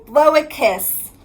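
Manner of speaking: ordinary delivery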